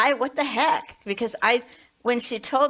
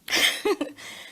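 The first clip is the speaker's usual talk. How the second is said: short breathy laugh; 11 semitones higher than usual. Real, not acted. Laughs at her own remark.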